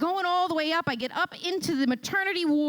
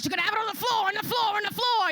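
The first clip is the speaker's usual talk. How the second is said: higher, shouted repetition of the nurse; panicked echo; 8 semitones higher, faster than usual. Retold or imitated. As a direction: yell it back in a panicked mock accent. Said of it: "a parade of accents".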